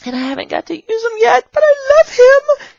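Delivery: very high, put-on tearful gush; mock whine. Gushing over a prop not used yet, mock-crying squeal. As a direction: whimper it with playful adoration.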